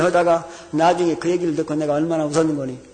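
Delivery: normal talking voice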